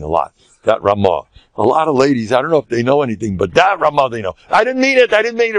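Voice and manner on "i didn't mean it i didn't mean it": pleading apology. Pretend. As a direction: plead like a sorry husband. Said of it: "All the ladies, please don't shut me off".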